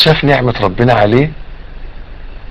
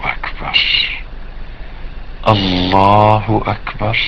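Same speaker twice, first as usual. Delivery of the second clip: very soft, drawn-out, low takbir; slow reverent dhikr; 5 semitones lower, 10 dB quieter, slower than usual. Shown as a demonstration. Preacher modelling remembrance after prayer, hushed and slow. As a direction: stretch each phrase slowly and let it sink away.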